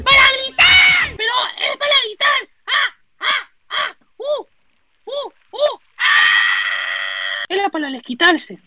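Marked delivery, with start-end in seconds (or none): 0.0-2.5 high, loud shout. Shown as a demonstration. Showing the room for screaming, high shouted words. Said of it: "Esta habitación sirve para gritar". 2.7-7.5 screams. Shown as a demonstration. Demonstrating the screaming room, short screams. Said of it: "sirve para gritar".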